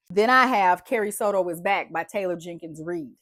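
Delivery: ordinary talk, nothing marked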